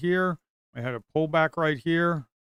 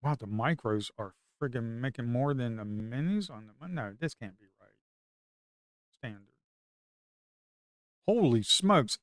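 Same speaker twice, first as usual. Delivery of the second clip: normal talking voice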